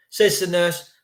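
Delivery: normal talking voice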